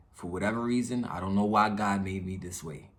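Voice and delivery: ordinary talk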